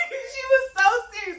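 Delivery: high laughing squeal through the words; can't get it out for laughing; giggling fit mid-story; laughing hard. Real, not acted. Laughing at her own story.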